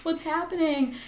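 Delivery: high, squeaky panicked self-talk; self-conscious inner voice. Retold or imitated. On the beach worried about looking weird, squeaky panicked self-talk. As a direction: play your own embarrassed inner voice way up high. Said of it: "I'm like".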